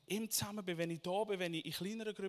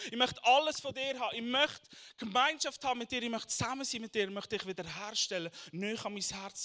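louder preaching, pitch raised; 5 semitones higher, 7 dB louder than usual. Acted. Preacher voicing God calling each person, forceful preaching. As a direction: call it out as God's invitation. Preacher voices God's call.

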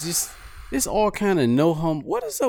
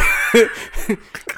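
high breathless laugh, suddenly louder; loud burst of laughter; 7 semitones higher, 6 dB louder than usual. Real, not acted. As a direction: crack up loudly at the thought. Bursts out laughing mid-rant.

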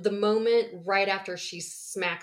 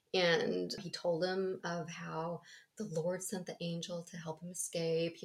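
ordinary talk, nothing marked

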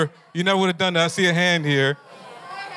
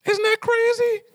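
much higher, squealed disbelief; incredulous. Real, not acted. Preacher to the kids about the stew trade, high squealed disbelief. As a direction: squeal it in mock shock. Preacher reacts to Jacob's bargain.

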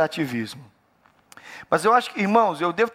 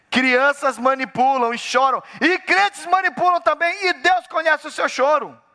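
fervent preaching; 11 semitones higher, 3 dB louder than usual. Acted. Pastor warning believers, preaching hard. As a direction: lean into each word as a warning.

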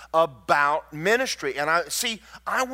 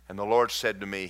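ordinary talk

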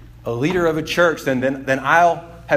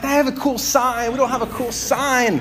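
envious whine; 8 semitones higher than usual. Retold or imitated. Envious pastor eyeing church sign.

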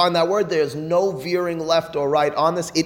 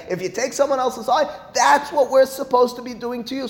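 emphatic warning; 10 semitones higher than usual. Acted. Teacher voices God's warning, severity.

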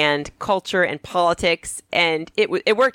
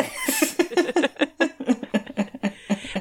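big laugh; 11 semitones higher, 4 dB quieter than usual. Real, not acted. Laughs at own joke.

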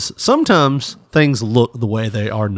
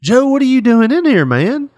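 barfly greeting; 12 semitones higher, 4 dB louder than usual. Acted. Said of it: "he's like".

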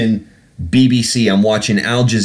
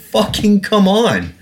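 slow, much higher exasperated plea; irate; 11 semitones higher than usual. Real, not acted. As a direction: drag it out in real frustration. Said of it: "don't mean to get irate".

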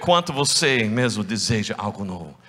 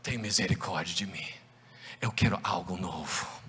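soft plea; 5 semitones lower, 10 dB quieter than usual. Acted. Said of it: "começar gritando".

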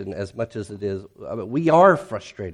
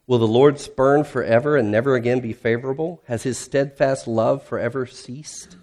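impassioned psalm reading; 3 semitones higher, 4 dB louder than usual. Acted. Reads Psalm 77.

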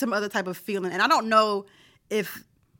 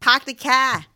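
hammed-up Boston accent, louder; 3 semitones higher, 5 dB louder, slower than usual. Co-host mocking how Boston people talk, in a Boston accent. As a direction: drop the r in Boston style, pahk the cah. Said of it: "talk as bad as the people in Boston".

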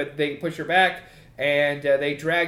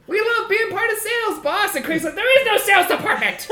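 much higher, chirpy creature voices; high squeaky voices; 4 dB louder than usual. Acted. Kobolds to their boss, high chirpy voices.

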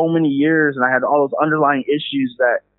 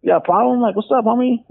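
higher, confrontational mock challenge; mock tough challenge; 9 semitones higher than usual. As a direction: square up to him, half-joking. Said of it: "and I'm like".

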